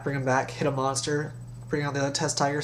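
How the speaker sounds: ordinary talk, nothing marked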